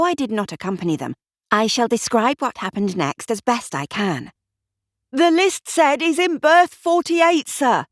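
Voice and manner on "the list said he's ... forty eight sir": much higher, louder bellow. A narrator's reading. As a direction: bellow over waves and seagulls. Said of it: "bellowed Constable Reese".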